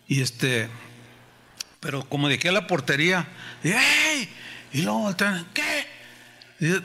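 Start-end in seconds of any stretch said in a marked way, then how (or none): 3.7-4.3 high shout across the yard; calling to the boys from afar. Retold or imitated. Calling out from distance. 5.5-5.9 high, snappy what; a tough kid turning round; a boy answering back; defiant retort. Retold or imitated.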